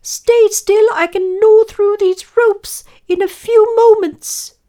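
loud, very high, urgent squeak; determined mouse. A narrator's reading. Mouse gnaws the lion's ropes, urgent squeak. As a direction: call out instructions in a piping mouse voice.

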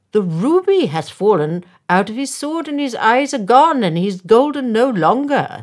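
blustering mayor; pompous mayor. A narrator's reading. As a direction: proclaim it like an indignant official. Pompous mayor inspecting the statue.

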